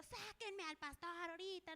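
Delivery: mock angry demand. Husband demanding the pastor, mock angry demand. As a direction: demand it like a furious neighbour, half in earnest.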